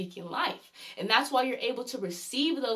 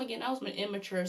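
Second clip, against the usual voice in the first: usual speaking voice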